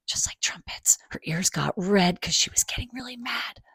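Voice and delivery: put-on soft whisper to the kids; whispered aside. A narrator's reading. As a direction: share it like a secret. Narrator's hushed aside.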